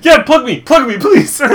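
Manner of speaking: high, laughing plea. Real, not acted. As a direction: squeal through laughter. Laughing while begging.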